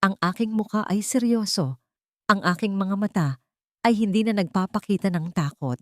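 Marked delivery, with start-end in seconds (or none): none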